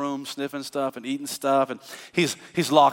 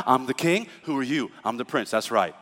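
quiet firm reminder; 5 semitones lower, 5 dB quieter than usual. Retold or imitated. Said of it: "I'm like".

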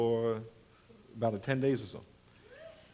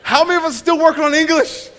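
higher, louder teasing question; teasing quiz; 9 semitones higher, 6 dB louder than usual. Retold or imitated. Preacher teasing the congregation about English, louder and higher. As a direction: poke fun at the congregation. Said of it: "mocking his own audience".

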